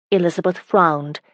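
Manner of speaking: ordinary delivery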